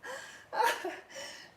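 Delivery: high breathy laugh; breathy giggle. Real, not acted. Giddy after workout.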